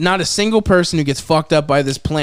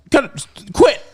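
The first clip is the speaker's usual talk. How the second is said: high, louder pretend plea to quit; mock ticklish protest; 11 semitones higher, 4 dB louder than usual. Mushkin in the plant's mouth, mock ticklish protest. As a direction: squeal it as if being tickled, in jest. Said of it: "It's like somebody's tickling you".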